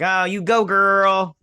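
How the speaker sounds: loud, high cheer; mock cheer.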